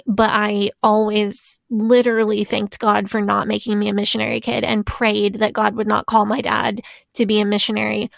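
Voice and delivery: normal talking voice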